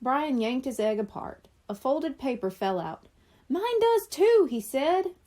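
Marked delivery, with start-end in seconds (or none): 3.5-4.5 put-on excited boy. A narrator's reading. Boy finds note too.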